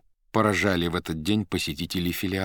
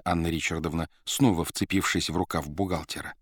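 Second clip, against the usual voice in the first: ordinary delivery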